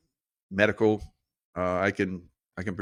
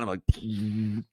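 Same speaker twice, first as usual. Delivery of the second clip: usual speaking voice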